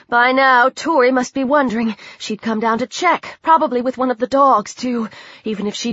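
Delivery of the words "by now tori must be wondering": plain reading voice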